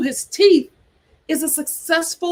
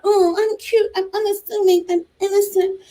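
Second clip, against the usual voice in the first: higher, coy innocent voice; mock innocent; 5 semitones higher than usual. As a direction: act sweet and harmless. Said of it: "I think that is her".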